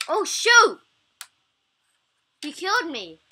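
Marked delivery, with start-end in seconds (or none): 0.1-0.8 sudden loud yell; shouting. Real, not acted. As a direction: shout it out in shock. Got killed in the game.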